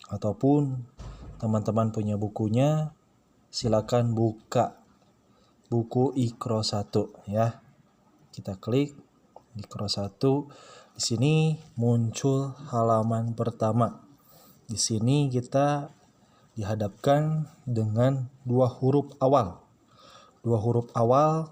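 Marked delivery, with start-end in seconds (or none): none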